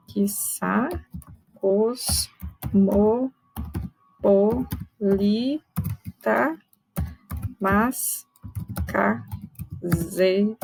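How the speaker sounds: slow metrical reading; slow scansion. Shown as a demonstration. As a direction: beat out "cos-mo-po-li-ta" syllable by syllable. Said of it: "vamos tentar o último verso".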